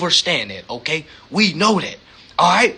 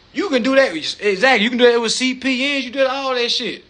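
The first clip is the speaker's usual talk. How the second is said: ordinary talk, nothing marked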